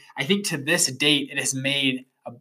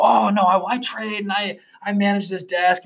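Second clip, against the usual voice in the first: lightly mocking, higher-pitched analyst voice; mocked analyst; 7 semitones higher than usual. Young analyst telling the podcaster about the job, mock self-important. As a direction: imitate a self-important desk trader.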